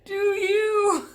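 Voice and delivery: high, loud disbelieving squeak; incredulous mock. Passengers teasing the drunk man, high incredulous squeak. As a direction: scoff in high-pitched disbelief. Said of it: "And we were all like".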